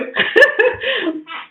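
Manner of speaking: a burst of high laughter; short real laugh. Real, not acted. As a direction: laugh out brightly. Laughs at own remark.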